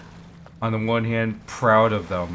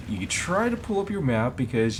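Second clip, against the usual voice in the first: normal talking voice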